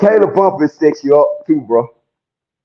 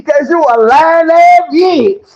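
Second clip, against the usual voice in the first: furious shout; 9 semitones higher, slower than usual. Real, not acted. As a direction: spit each word at her. Answering her, furious.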